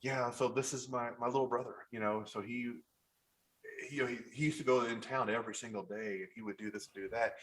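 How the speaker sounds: a hint of a sorrowful sibling; tender, grieving. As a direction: quietly mourn a little brother. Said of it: "I had to say it like".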